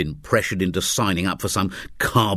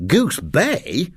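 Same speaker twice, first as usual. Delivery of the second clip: surprised; 10 semitones higher than usual. Acted. Startled at unexpected landing.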